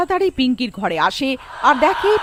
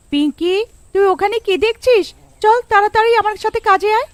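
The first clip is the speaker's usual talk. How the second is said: higher, louder mother summoning her daughter; mother calling; 10 semitones higher, 3 dB louder than usual. Acted. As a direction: call her over, impatient. Mother calls Pinky to work. (মায়ের জোরে ডাক)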